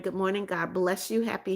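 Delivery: ordinary talk, nothing marked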